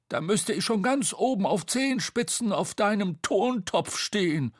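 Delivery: high, lively cartoon-like character voice; high-pitched figure explaining eagerly; Bo, lively and insistent. Bo objecting to Tete, high and insistent.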